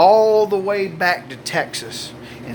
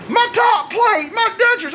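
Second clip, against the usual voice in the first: panicked wife. Retold or imitated. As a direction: imitate a frantic wife confessing. Panicked wife confesses about dentures.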